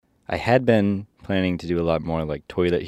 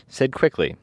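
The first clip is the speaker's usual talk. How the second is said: plain reading voice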